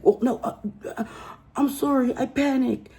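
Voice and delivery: high, whiny mock panic. Woman begging the cop not to leave, high fake panic. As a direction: plead in a fake-frantic voice. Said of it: "defensive mode".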